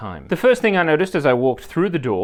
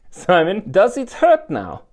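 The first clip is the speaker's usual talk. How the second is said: high, loud mock-Slavic accent; her Polish accent; 5 semitones higher, 7 dB louder, slower than usual. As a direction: imitate the Polish masseuse, drawn out and high. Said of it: "in her accent".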